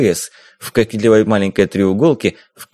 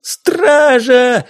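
high yell for the guards; girl screams for the guards, much higher; 12 semitones higher, 4 dB louder than usual. Acted. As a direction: shout it across the pier. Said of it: "заорала симпатичная девица".

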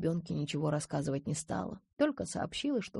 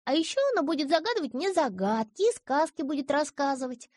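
put-on high bright little girl voice; child voice; 9 semitones higher, 5 dB louder than usual. A narrator's reading. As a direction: speak as an eager little girl. Girl's voice in audiobook. (голос маленькой девочки)

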